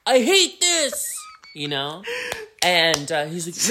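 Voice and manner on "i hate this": very high, loud drawn-out wail; hammed-up tantrum. Upset man at the giggling bar, hammed-up high tantrum. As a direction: stretch it into a slow, high mock tantrum.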